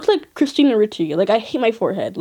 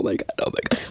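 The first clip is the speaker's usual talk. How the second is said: softer awestruck whisper; breathless whisper; 9 dB quieter, much faster than usual. Retold or imitated. Starstruck fan meeting the singer, awed whisper. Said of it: "And I'm like".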